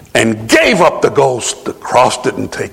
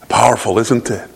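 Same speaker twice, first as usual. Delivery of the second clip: ordinary talk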